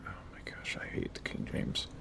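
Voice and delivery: whispered exasperated aside; whispered groan at the KJV. Real, not acted. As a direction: breathe it out in exasperation. Exasperated aside while reading scripture.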